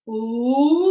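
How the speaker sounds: long low whale call; whale sound. Shown as a demonstration. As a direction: moan like a whale.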